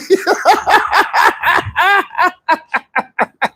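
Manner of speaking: long, high laughing fit; high-pitched cackle; cracking up. Real, not acted. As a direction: burst into high, helpless laughter. Cracking up at "your celly.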